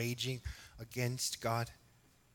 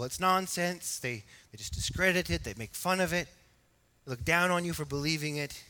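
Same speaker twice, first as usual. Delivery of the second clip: loud, raised preaching voice; full-force preaching; 4 semitones higher, 6 dB louder than usual. Acted. Preacher voicing the scoffers to the church, fervent preaching. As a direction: preach it with fire. Preacher mocks the intellectuals' view.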